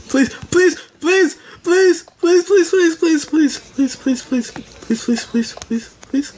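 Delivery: high frantic pleading, please repeated; desperate begging. Real, not acted. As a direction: beg for your life, fading out. Begging while being hunted.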